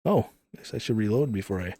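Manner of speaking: usual speaking voice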